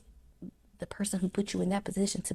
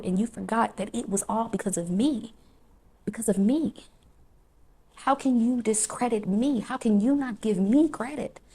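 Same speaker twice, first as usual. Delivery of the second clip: rising, louder preaching, building in force; preaching God's rebuke; 2 semitones higher, 4 dB louder than usual. Acted. God rebuking the forgetful believer, preaching louder. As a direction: let God's rebuke swell like a sermon.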